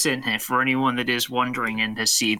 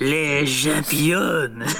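put-on French accent; much slower than usual. Mock French waiter.

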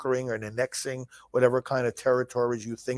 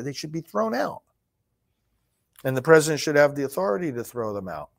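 ordinary talk, nothing marked

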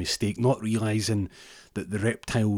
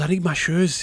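urgent; 10 semitones higher, 6 dB louder than usual. Retold or imitated. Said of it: "he goes".

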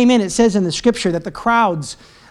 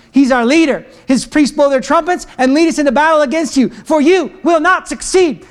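raised, emphatic battle-line declamation; proclaimed speech; 4 semitones higher, 3 dB louder than usual. Acted. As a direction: declare it boldly to the enemy army. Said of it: "starts speaking these words out".

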